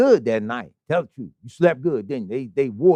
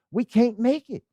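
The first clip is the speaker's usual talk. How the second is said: normal talking voice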